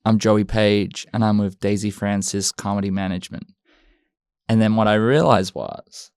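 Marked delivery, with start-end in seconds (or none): 0.0-3.5 low-pitched American audition voice; American accent. Acted. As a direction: put on a deep, deliberate American voice. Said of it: "Can you do your ident in an American accent".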